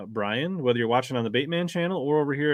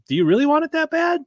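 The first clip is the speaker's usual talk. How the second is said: high, louder retold question; incredulous challenge; 7 dB louder than usual. Retelling his reaction to the angler's excuse, incredulous. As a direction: challenge him in raised-pitch disbelief.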